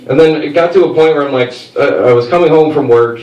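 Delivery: normal talking voice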